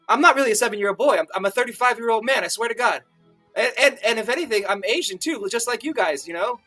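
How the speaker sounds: fast, high, stammering plea; panicked rambling. Acted. Sam pleads with two eunuchs, rambling fast in panic. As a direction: gabble it out high, words spilling over each other. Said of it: "I began rambling as fast as I could".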